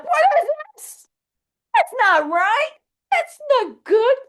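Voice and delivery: high, far-off whine of a let-down fan; whiny fan complaint. Retold or imitated. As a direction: whine it like a disappointed Trekkie. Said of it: "you're like".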